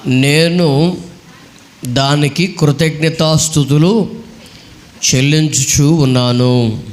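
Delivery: slow, drawn-out verse reading; scripture reading. Real, not acted. Preacher reads the psalm to the congregation, slow scripture reading. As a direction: read the verse in long, measured phrases.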